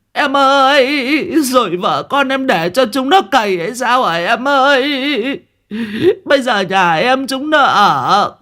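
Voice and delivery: hammed-up keening over the brother; sobbing lament. Liên wails over her brother in front of the neighbours, theatrical keening. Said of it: "nước mắt nước mũi tèm lem".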